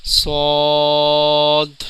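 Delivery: صاد heavy and rounded, very long, ending in d; full and thick. Shown as a demonstration. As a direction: stretch a thick صاد slowly to its d. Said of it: "اس کے بھی اینڈ میں دال کی آواز".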